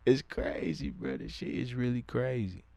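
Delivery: ordinary talk, nothing marked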